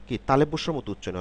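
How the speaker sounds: ordinary talk, nothing marked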